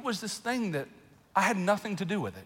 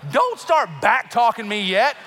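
loud, higher mock parental telling-off; mock scolding; 3 semitones higher, 6 dB louder than usual. Parent to child who just started talking, mock scolding. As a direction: scold with playful, exaggerated indignation.

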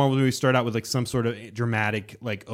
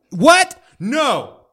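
much higher, much louder stunned cry; real shock at the twist; 12 semitones higher, 8 dB louder than usual. Real, not acted. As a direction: blurt it in disbelief. Reacting to Loki's death.

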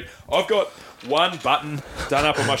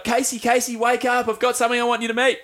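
high, eager wake-up call; imitated mother waking daughter; 9 semitones higher than usual. Old woman shaking her sleeping daughter awake, imitated eager calling. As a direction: rouse someone urgently in a raised, imitated voice.

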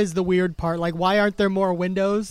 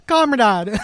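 put-on loud Jamaican accent on a Russian word; Jamaican-Russian mash-up accent; 3 semitones higher, 7 dB louder than usual. Imagined Russian dude greeting someone, loud mock Jamaican accent. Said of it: "with, like, Russian pronouns".

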